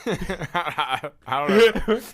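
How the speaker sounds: can barely get "I don't know" out for laughing. Real, not acted. Reacting to a dirty joke.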